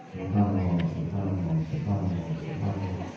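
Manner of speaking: low, soft, calm chant of praise; calm dhikr. Acted. Wife softly reciting dhikr as husband shouts, calm. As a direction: murmur it serenely while he rages.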